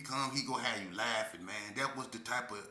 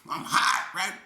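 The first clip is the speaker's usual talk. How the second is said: ordinary delivery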